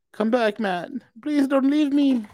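high, pleading whine; whiny mock plea. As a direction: beg him to come back, whining. Mock plea to absent cohost.

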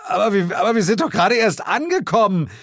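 high, loud, fast pleading; flustered protest. Acted. Narrator begs Sir James, flustered. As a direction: stammer objections.

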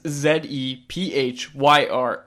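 slow, letter by letter; spelling it out. Shown as a demonstration. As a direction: spell each letter out slowly and clearly. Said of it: "spells the brand name out".

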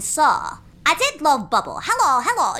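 high, slow, hammed-up Bubble voice; squeaky sitcom character voice; Bubble impression. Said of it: "grew up watching Absolutely Fabulous".

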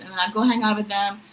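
normal talking voice

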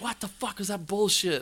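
high-pitched mock outrage; incredulous group complaint, acted out; imitated outrage. Friends complain in the car.

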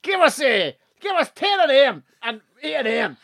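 high-pitched Scottish drinker caricature; thick Scottish accent. Retold or imitated. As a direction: order in broad Scots, high and comic. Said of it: "had the same accent as the fella".

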